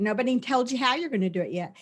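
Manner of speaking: normal talking voice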